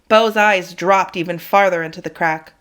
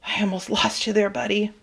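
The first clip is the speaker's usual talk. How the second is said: soft, fast, breathless wheeze; wheezed; 6 dB quieter than usual. Acted. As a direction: gasp it into his fur. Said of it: "I wheezed into his fur".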